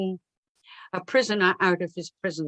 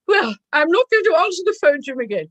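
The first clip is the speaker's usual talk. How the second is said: indignant secretary; 7 semitones higher, 5 dB louder, faster than usual. Retold or imitated. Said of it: "secretary Alice replies".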